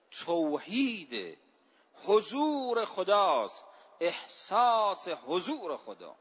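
slow, weighty stress on توحید and حضور; emphatic. Acted. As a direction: lean hard on توحید, then حضور خداست. Preacher stresses key doctrine.

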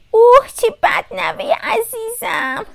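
high-pitched grandmother voice; sympathetic grandmother. A narrator's reading. Grandmother pities her grandchild's cold, high sympathetic voice. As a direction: pity the caller in a high voice.

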